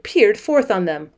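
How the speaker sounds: ordinary delivery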